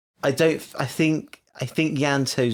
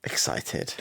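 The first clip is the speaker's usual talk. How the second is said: breathless whisper, much softer; whispered answer; 8 dB quieter than usual. Real, not acted. Asked about the new couple, she whispers her answer. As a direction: whisper it like a guilty secret.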